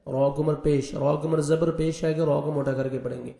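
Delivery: normal talking voice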